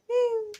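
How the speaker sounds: high playful meow at the cat. Pretend. Meowing at the cat.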